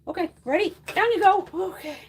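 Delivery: high coo while lowering the cat. Real, not acted. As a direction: set the cat down gently but brightly.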